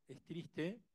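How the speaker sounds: ordinary talk, nothing marked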